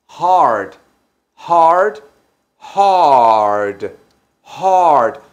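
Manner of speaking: hard said slowly, each h breathed out; hard, clear h and strong r; teaching hard. Shown as a demonstration. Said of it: "se dice igual que difícil". (pronunciando hard despacio con h aspirada)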